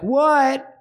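calling back. Retold or imitated. Kid answering call from basement.

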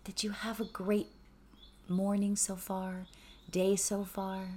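soft, calm meditation voice, sometimes whispered; hushed gratitude meditation; guided meditation. Acted. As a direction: ease listeners inward with gentle questions.

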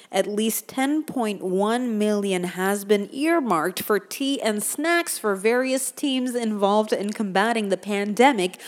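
usual speaking voice

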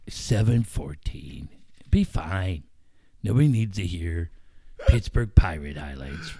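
a hint of a bored colleague; lightly voiced Marcus. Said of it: "Marcus voiced, low and slow".

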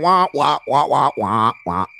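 Charlie Brown teacher wah wah; muffled wah wah talk. Retold or imitated. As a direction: mimic droning wah wah noise. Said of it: "'Cause I'm gonna be like".

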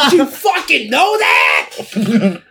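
high, loud, slow laughing shout; mock Kinison yell. Echoing the clip's ranting woman, a slow high shout. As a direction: bellow it like a comic's rant. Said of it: "is she Sam Kinison?".

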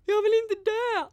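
very high, loud, slow wail; desperate cry. Retold or imitated. Friend being put in the ambulance, imitated desperate cry. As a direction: wail it out, imitating terror of dying.

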